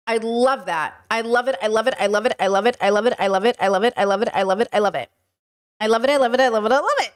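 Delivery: rapid-fire low chant, over and over; obsessed gushing. Real, not acted. Streamer obsessing over the accent video, gushing chant. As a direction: repeat it like a stuck record, popping up at the end.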